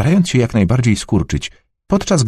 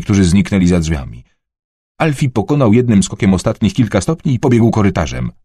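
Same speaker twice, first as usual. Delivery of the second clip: plain reading voice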